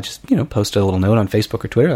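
ordinary talk

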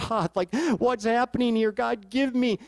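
a hint of Gideon on his knees begging; pleading Gideon. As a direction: ask God for help in confusion. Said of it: "He goes".

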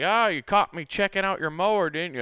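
high, loud playful jab at a neighbor; teasing owner. Retold or imitated. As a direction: catch him out with a grin in the voice. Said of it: "and he's just like".

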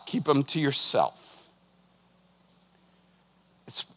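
ordinary talk, nothing marked

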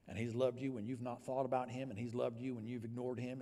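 ordinary talk, nothing marked

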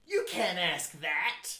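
loud, mock show-title announcement; segment announcer. Host announcing a segment to co-host, mock announcer. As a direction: call it out like a game-show title.

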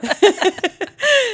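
high, loud burst of laughter. Real, not acted. Host giving away a friend's name, bursts out laughing.

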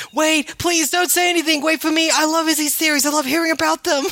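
high-pitched mock begging, all in a rush of words; hammed-up high, frantic pleading; screaming plea. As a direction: act out an over-eager fan begging not to miss out. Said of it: "Joe just screaming".

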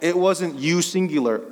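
ordinary talk, nothing marked

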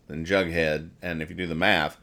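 usual speaking voice

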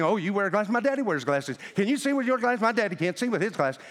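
put-on high, fast toddler chatter; chattering little girl. Little girl pestering the yuppie passenger, high fast chatter. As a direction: rattle off eager questions like a two-year-old. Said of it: "jabbering, like Daffy Duck".

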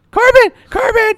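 very high, loud mock yell; high-pitched yelling. Host teasing co-host with an exaggerated film impression, high-pitched yelling. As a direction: shriek the name in a silly falsetto. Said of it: "He doesn't yell it like that always".

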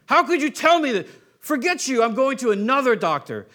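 hammed-up angry patient telling someone off. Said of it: "Hopefully you don't get angry".

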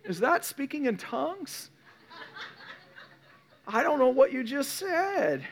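high-pitched baffled grandma; elderly grandma. Acted. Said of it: "She's gonna be like".

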